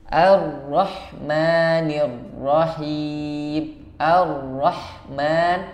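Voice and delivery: slow drawn-out Ar-Rahmanir Rahim, lips not pushed forward; tajwid recitation. Shown as a demonstration. Teacher demonstrating Ar-Rahmanir without pouting lips. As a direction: stretch Ar-Rahmanir slowly, lips neutral. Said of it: "jangan monyong".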